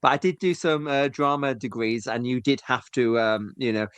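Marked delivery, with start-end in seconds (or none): none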